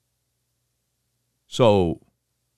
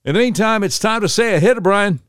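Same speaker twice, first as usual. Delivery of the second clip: announcer; 9 semitones higher, 3 dB louder than usual. Real, not acted. Announcing the segment.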